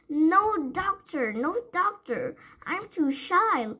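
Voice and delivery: put-on high, slow, whiny child voice; a touch of tearful misery; miserable child. A narrator's reading. Sick little girl wailing at the window cleaner, miserably. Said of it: "in that miserable way".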